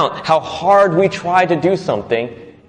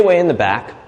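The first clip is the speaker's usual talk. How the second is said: ordinary talk, nothing marked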